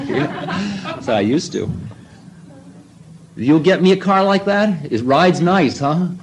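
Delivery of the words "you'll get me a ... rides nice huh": higher, louder playful guru coaxing; guru's voice. Retold or imitated. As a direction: sell it like a cheeky hustler. Guru asking for a car.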